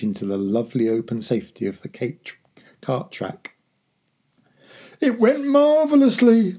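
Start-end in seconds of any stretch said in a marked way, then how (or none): 5.0-6.6 very high, loud and exultant; breathless triumph. A narrator's reading. Father after escaping the keeper, breathless elation. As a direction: crow it, out of breath. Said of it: "breathing heavily".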